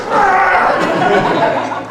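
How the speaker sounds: loud, high hearty laugh; big tiger laugh. Acted. Tiger laughing at rabbit after the boast, loud. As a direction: laugh big like a proud beast.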